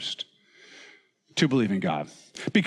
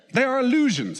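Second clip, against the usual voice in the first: pitched way up, declaring it grandly; a hammed-up professor's verdict, much higher; mock Sigmund Freud voice; 11 semitones higher than usual. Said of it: "I'm doing my best".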